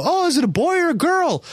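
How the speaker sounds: put-on very high, excited cooing at a baby; hyped-up dad. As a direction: imitate a dad thrilled by a baby. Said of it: "he gets very hyped around kids".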